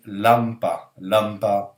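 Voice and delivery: slow, deliberate "lampa", said twice; Russian word read out. Shown as a demonstration. Teacher reads the L example word, slow demonstration. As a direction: sound out "lampa" syllable by syllable. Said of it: "ça nous donne".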